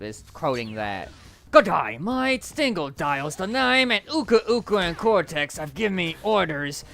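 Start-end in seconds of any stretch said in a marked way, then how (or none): 1.5-6.8 put-on Australian accent. Streamer showing off the Dingo Dial line, Australian accent. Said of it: "like, an Australian accent".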